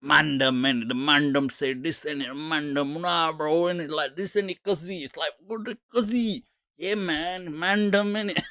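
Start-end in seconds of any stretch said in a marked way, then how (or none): 0.0-5.0 put-on soft, run-on Mandem street accent; imitated Mandem slang. Imitating people in the video, mock Mandem accent. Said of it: "people just all speaking". 5.5-8.4 high, soft mock Mandem accent; imitated Mandem slang. Imitating people in the clip, mock Mandem accent. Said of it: "people just all speaking".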